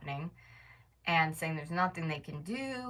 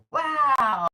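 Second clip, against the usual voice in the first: loud, high, drawn-out mock amazement; sarcastic wow; 8 semitones higher, 10 dB louder than usual. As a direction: stretch it out big in fake awe. Sarcastic reaction to obvious suggestion.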